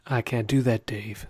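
HAL computer voice. Acted. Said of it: "speak in a robotic voice, very calm, with an English accent".

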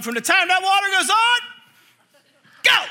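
high, mock drill order; urgent dad command. Dad times son's three-minute shower, urgent command.

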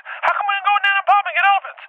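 put-on very high kid-like whine; imitated frantic complaint. Kids envious of the elephants, imitated high frantic complaint. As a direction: mimic kids losing their minds. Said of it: "My kids are there losing their fucking minds".

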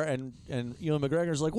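normal talking voice

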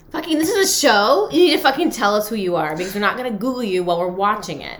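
loud, high, fed-up rant; exasperated rant. Retold or imitated. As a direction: yell at him in disbelief. Ranting at contestant.

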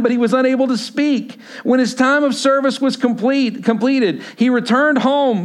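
loud, high, proclaiming Bible reading; scripture reading. Acted. Pastor reading Luke to his congregation, proclaiming. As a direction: proclaim the verses to the room. Reads scripture aloud in church.

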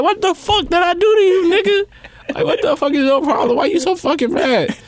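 high, pleading bewildered protest; mock exasperation. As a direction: imitate a friend demanding an explanation, pitch way up. Acting the bewildered friend's protest.

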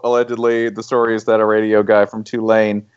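ordinary talk, nothing marked

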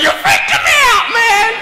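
very high, loud mock shriek; hammed-up, high-pitched panic; freaked-out girl. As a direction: imitate a girl screaming in horror. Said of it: "she's like".

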